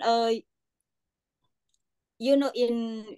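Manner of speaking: ordinary talk, nothing marked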